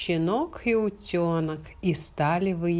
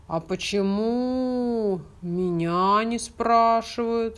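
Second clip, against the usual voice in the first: cow voice; 4 semitones higher, slower than usual. Acted. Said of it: "вмешалась она".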